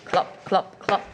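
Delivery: unvoiced clopping clicks; horse hooves clopping. Shown as a demonstration. Teacher making hoof sounds for the class. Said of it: "He can go like horse feet".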